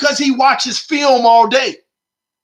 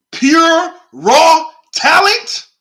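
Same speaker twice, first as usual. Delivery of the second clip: incredulous; 4 semitones higher, 3 dB louder, much slower than usual. Acted. Scoffing at the opponent's claim.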